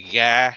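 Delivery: a deep G on "ga". Shown as a demonstration. Teaching friends the Vietnamese word for liver, deep G "ga". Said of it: "with a deep G sounding".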